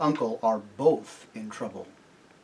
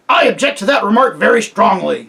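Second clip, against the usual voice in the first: louder, indignant gruff protest; offended bulldog; 5 semitones higher, 6 dB louder than usual. A narrator's reading. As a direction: bristle with offence. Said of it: "said the bulldog".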